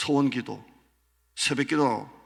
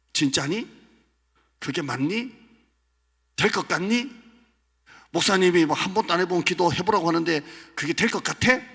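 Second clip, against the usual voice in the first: higher, louder sneering questions; mocking devil; 6 semitones higher, 7 dB louder than usual. Devil mocking a believer's prayer. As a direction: needle them with doubt.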